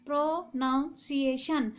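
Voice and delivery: "Pronunciation" broken into separate syllables. Shown as a demonstration. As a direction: sound out each part of "pronunciation". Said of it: "four syllable का बना है". ("Pronunciation" को हिस्सों में तोड़कर)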